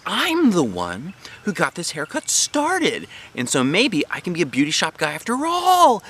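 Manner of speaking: put-on a kid struck by a great idea; real thrilled. A narrator's reading. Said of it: "I said, real thrilled".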